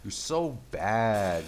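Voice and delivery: loud, slow mocking sing-song at the enemy; slow taunt. Real, not acted. Gamer mocking a beaten enemy, slow and loud. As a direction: drag out the insult to rub it in.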